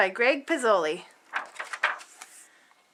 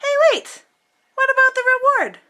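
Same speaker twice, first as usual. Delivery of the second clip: much higher, louder exclamation; sudden interruption; 11 semitones higher, 5 dB louder than usual. Acted. Reader remembering the reward, high and loud.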